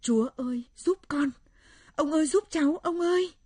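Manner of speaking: high, loud plea for help; panicked cry. Acted. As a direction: beg for help at the top of the voice. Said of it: "miệng kêu thất thanh".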